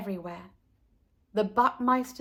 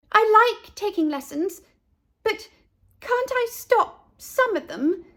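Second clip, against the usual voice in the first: pleading; 11 semitones higher, 5 dB louder than usual. A narrator's reading. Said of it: "pleaded Ernestine".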